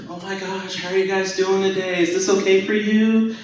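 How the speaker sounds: high, sweet, singsong nurse; sugary nurse voice. Retold or imitated. The real nurses mocking her bedside manner, high and sugary. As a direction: coo at the patients like a too-nice nurse. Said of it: "They're like".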